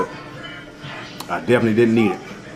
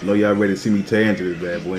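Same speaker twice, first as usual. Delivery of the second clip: normal talking voice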